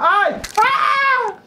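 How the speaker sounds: high, real shriek of alarm; sudden yelp as he falls; startled scream. Real, not acted. Falls in the game.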